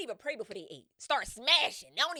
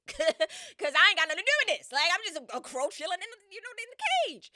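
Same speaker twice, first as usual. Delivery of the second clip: much higher, louder squawky plea; panicked crow; 11 semitones higher, 13 dB louder than usual. Pretend. The crow pleading to be left out of it, high and frantic. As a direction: beg like a scared little bird. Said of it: "if I was that crow".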